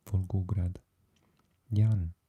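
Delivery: ordinary delivery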